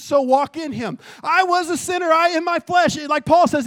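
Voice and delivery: loud, fervent pulpit preaching; preaching at full force. Acted. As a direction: drive it home like a revival preacher. Shouted preaching about Christ.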